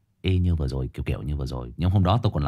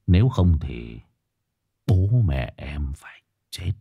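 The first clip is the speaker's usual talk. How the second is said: villain whisper; 2 semitones lower, 4 dB louder, much slower than usual. Shown as a demonstration. Villain role demo.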